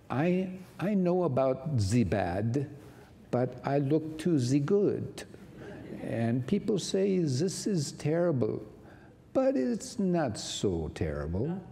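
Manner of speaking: a hint of an old German lady's accent, unhurried. Quoting the old pianist to the congregation, light German accent. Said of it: "And in her German accent".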